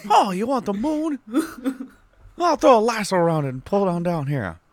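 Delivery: lightly voiced folksy leading man; Jimmy Stewart impression. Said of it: "like, a Jimmy Stewart".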